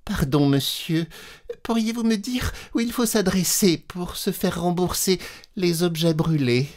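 a shy lady's tiny voice; small timid voice. Acted. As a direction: ask meekly, afraid to bother him. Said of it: "d'une petite voix timide".

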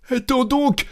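very high, urgent squeal; panicked cry. A narrator's reading. Cantor calling from inside the chest, high panicked cry. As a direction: cry out to stop them, voice leaping up. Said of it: "s'écria le chantre dans le coffre".

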